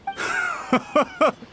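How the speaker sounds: high, sharp mocking laugh; a short scoffing laugh to brush him off; scornful laugh. Official scoffs at the commissioner's accusation with a mocking laugh.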